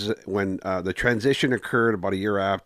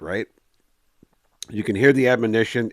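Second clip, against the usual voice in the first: usual speaking voice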